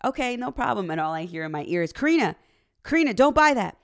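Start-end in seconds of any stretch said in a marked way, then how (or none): 0.0-0.9 mock sweet agreement. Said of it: "And so I was like". 1.9-3.8 high, loud panicked cries of her name; husband freaking out. Retold or imitated. Husband panicking over the price.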